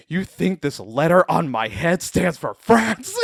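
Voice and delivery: loud, drawn-out indignant comeback; defiant retort. Retold or imitated. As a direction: belt it out high and slow as a heroic taunt. Acted Captain America's defiant retort.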